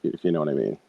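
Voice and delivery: normal talking voice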